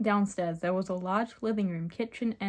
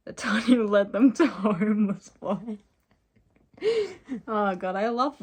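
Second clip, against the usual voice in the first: reading through real laughter; cracking up; 3 semitones higher, 7 dB louder than usual. Real, not acted. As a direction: lose it laughing mid-sentence.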